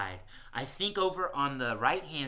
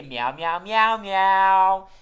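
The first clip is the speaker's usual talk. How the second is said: leading group meows; 4 semitones higher, 12 dB louder, much slower than usual. Pretend. Host leads children greeting the cat, swelling meows. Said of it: "Everybody say".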